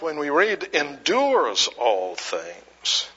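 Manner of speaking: ordinary talk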